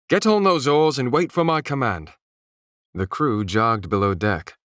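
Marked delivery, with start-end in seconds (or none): 0.1-2.1 loud, high barked command; yelled. A narrator's reading. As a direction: shout the order down the deck. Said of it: "yelled Gunnar".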